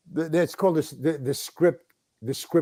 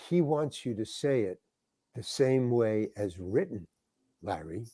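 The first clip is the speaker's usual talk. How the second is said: hushed, lower voice, measured and discreet; quiet correction; 5 semitones lower, 10 dB quieter than usual. Retold or imitated. Script supervisor discreetly correcting the actor on set, quiet and low. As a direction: softly lay down the rule, trailing down low.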